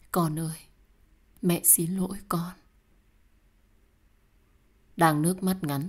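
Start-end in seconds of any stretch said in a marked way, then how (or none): 0.1-2.5 whispered, sorrowful plea; grieving apology. Acted. Mother apologizing to her lost child, grieving whisper.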